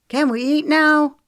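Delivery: put-on high, loud impatient teen whine; exasperated. A narrator's reading. Teenage boy to the family, exasperated high whine. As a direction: complain like a hungry teenager who can't wait. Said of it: "asked in exasperation".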